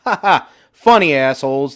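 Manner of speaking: high, loud mocking laugh and jab; man below mocking them back; sarcastic retort. A narrator's reading. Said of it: "the man returned fire".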